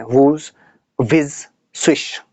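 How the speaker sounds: drawn-out, breathy whoosh and swish like gusts of wind; a teacher voicing the wind for the class; imitating wind sounds. Shown as a demonstration. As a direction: stretch each wind word out like air rushing past. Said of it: "wind की भी अलग-अलग आवाज़ें".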